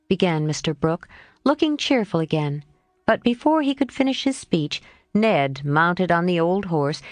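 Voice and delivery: usual speaking voice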